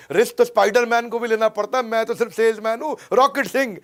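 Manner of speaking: high, loud movie-line delivery; film dialogue. Acted. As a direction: punch it out like a famous film quote. Quotes famous film line with gusto.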